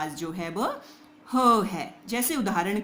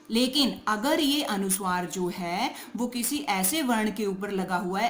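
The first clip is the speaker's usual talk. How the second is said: usual speaking voice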